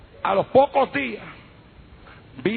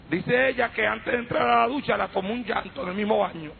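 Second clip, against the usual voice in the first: normal talking voice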